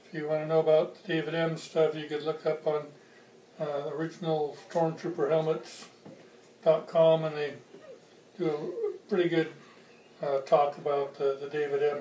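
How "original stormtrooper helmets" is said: usual speaking voice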